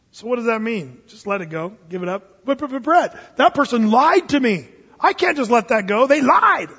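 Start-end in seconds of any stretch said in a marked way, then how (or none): 2.4-6.7 high, loud, indignant "but they lied"; mock indignant whine; whining objector. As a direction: protest like a wounded churchgoer. Objector voiced, whining protest.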